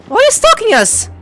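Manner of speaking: ordinary delivery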